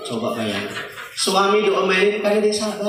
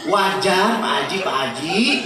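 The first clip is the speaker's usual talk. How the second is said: ordinary delivery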